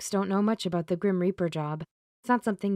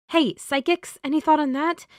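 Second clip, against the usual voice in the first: raised, higher call to the room; 9 semitones higher, 5 dB louder than usual. Acted. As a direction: call out to the listening psychics. Calling out to unseen psychics.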